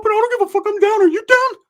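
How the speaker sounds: very high, loud cartoonish voice; squeaky, defiant mock shouting; worked-up cousin. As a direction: imitate a fired-up cousin yelling in falsetto. Acting out primo's defiant outburst.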